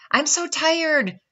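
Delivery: high, slow, loud groan. A narrator's reading. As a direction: groan it out, worn out. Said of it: "groaned the little tree".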